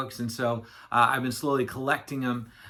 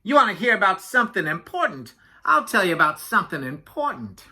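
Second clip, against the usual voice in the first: higher-pitched blustering talker; chatty Yak; 4 semitones higher, 4 dB louder than usual. Acted. Yak cutting in on Walrus, chatty and blustering. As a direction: take over the conversation as Yak.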